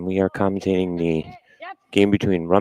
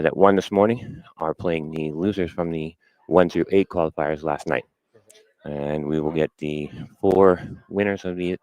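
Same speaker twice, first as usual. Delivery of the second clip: usual speaking voice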